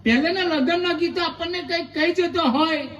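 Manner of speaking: ordinary delivery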